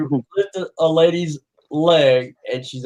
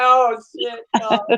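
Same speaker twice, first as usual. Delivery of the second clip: alarmed; 7 semitones higher than usual. Retold or imitated. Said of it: "He's like".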